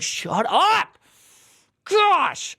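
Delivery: high, loud fed-up shout; exasperated yell. Real, not acted. Streamer venting at Gemini with a loud yell. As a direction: blow up at the chatbot.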